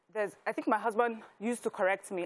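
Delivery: normal talking voice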